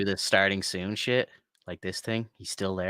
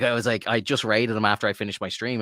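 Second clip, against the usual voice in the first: ordinary delivery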